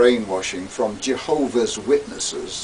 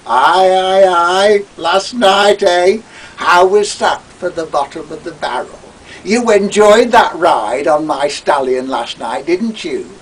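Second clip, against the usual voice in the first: louder, leering gloating voice; gloating Toad; 6 semitones higher, 8 dB louder than usual. Acted. Toad gloating over his guest at breakfast, loud and leering. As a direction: nudge him with a wicked leer. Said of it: "the gloating Toad was triumphant".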